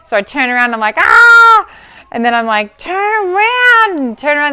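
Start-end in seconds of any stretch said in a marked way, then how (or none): 1.0-1.7 high, loud scream as the cold water hits; shocked yelp. Retold or imitated. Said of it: "I yell at myself". 2.8-4.5 high, slow, drawn-out yell; yelling at myself. Retold or imitated. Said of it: "I yell at myself".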